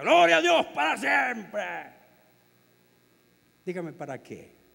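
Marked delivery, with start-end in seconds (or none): 0.0-1.6 loud, high, slow attention-seeking shout; mock showy yell of praise; show-off shout. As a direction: bellow it so everyone notices you. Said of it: "y grita".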